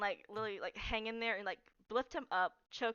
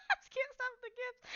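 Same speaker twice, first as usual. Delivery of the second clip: high-pitched giggling words; laughing; 14 semitones higher than usual. Real, not acted. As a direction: speak through giggles.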